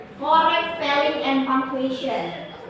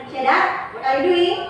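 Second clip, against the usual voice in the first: mildly angry, firmer and raised; angry question; 2 semitones higher, 3 dB louder than usual. Shown as a demonstration. Teacher shows how intonation changes the meaning, mildly angry. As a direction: demand it with a touch of anger.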